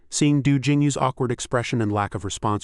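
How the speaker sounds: ordinary delivery, nothing marked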